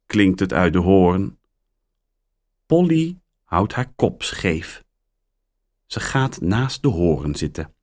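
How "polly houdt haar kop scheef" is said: ordinary delivery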